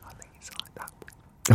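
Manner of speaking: hushed, breathy whisper. Shown as a demonstration. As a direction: whisper it right under your breath. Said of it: "But that's a whisper".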